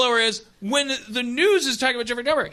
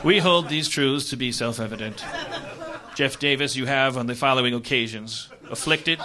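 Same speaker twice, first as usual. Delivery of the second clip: formal reading; 9 semitones lower, 3 dB quieter than usual. Real, not acted. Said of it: "A list of microaggressions".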